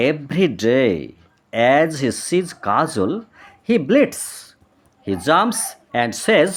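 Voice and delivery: put-on slow, clear story voice for children; story reading for kids. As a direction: read it aloud slowly to young pupils.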